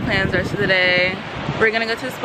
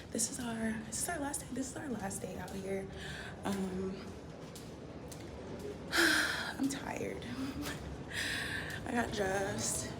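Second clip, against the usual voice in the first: hushed morning vlog; whispering; 14 dB quieter than usual. Real, not acted. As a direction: keep it whispered.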